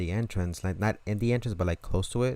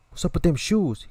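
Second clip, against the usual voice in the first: menacing demand; 9 semitones higher, 4 dB louder than usual. Retold or imitated. Robber demands the shoes.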